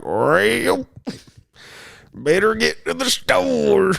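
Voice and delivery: high, slow, exaggerated Southern drawl; old Southern dude. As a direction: stretch the words like an old Southern man. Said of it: "old Southern dude that's just like".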